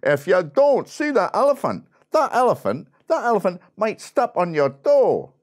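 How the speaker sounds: put-on loud, high Scottish accent; indignant Scottish bandsman calling out; Scottish bloke. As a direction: warn forcefully in a broad Scottish voice. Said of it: "one of the Scottish blokes in the Scottish band".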